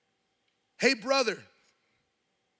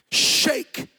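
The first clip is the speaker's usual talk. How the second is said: the word bursts out of him, much higher and louder; cried-out word; 8 semitones higher, 9 dB louder than usual. Real, not acted. Preacher telling his church how his sister shook, cried out high and loud.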